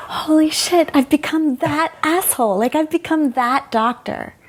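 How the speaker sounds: high, laughing dismay; horrified at herself, half laughing; sudden self-aware shock; shocked realization. Retold or imitated. Realizing she became that doctor.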